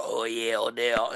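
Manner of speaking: low, quiet villain purr; evil villain voice. Retold or imitated. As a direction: sneer it like a cartoon villain. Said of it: "he made that voice for Odell Beckham".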